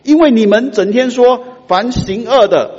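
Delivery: loud, high, slowing accusation; prophet's accusation. Acted. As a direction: hammer the charge home. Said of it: "马拉基就说". (马拉基大声指责会众)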